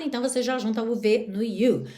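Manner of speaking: usual speaking voice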